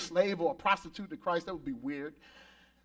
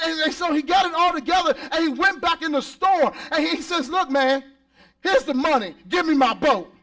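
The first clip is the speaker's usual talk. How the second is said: higher and louder, driving the story; preaching at full force; 5 semitones higher, 3 dB louder than usual. Real, not acted. Pastor preaching the boy's story loudly to the church.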